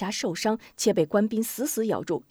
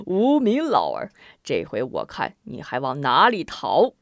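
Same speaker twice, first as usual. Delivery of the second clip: gloating taunt; 6 semitones lower than usual. Acted. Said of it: "边豪哈哈大笑".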